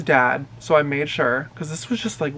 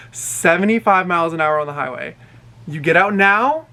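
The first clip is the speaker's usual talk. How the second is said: ordinary delivery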